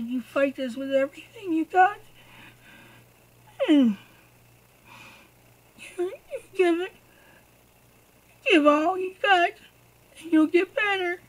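rally a sick daughter with all you've got; urging her to fight. Retold or imitated. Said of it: "I said".

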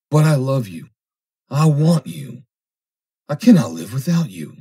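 lovesick, emphatic pleading; impassioned plea. A narrator's reading. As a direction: plead with feeling, voice lifted. Lovesick man pleads with woman.

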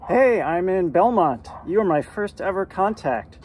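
ordinary talk, nothing marked

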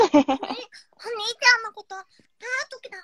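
high sweet little-sister voice; cute little sister voice. Pretend. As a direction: coo it like a kid sister. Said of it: "言ってあげて".

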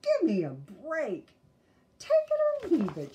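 put-on loud bossy girl; take it or leave it. A narrator's reading. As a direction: put your foot down.

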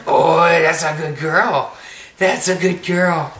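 loud, slow, drawn-out praise; excited praise. Real, not acted. Praising baby loudly.

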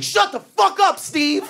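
high mock shout; comics yelling. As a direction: yell it up high at the manager. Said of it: "the comics would be like".

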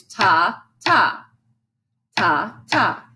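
"ta, ta" as two long, separate beats; teacher counting out "ta, ta"; rhythm syllables. Shown as a demonstration. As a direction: chant "ta, ta" slowly and evenly. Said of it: "this rhythm right here is".

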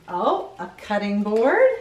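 plain reading voice

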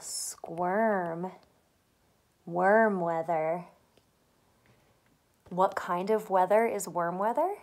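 normal talking voice

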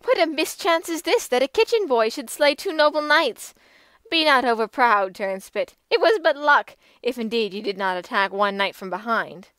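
scornful taunt; mocking. A narrator's reading. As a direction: belittle his victory. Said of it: "the damsel mocked him".

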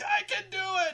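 high, loud acted shout; over-the-top shouted reply; villain's yell. Tempest answers the taunt.

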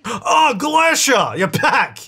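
high, excited gasp of recognition; delighted greeting. Pretend. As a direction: hail a returning favorite customer. Greets returning customer with a gasp.

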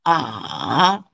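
"A" dipping low, then rising at the end; drawn-out "A" that sinks and turns back up; third tone. Shown as a demonstration. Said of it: "third tone".

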